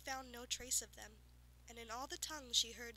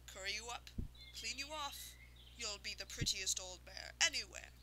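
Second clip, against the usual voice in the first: wheedling farmer; 2 semitones lower than usual. Acted. Farmer sweet-talking the unicorn.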